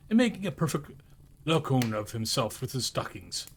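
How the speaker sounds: usual speaking voice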